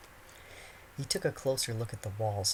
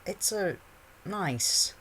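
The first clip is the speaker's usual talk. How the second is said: put-on raised, hesitant teen boy; awkward boy voice; 9 semitones higher, 3 dB louder, much slower than usual. A narrator's reading. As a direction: stumble over the words awkwardly. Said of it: "Harry replied".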